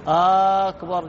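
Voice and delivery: أكبر with a long drawn "aaa" at the start; stretched hamza. Shown as a demonstration. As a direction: slowly say "aaakbar", holding the first vowel. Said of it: "يمد الهمزة". (مد همزة "أكبر")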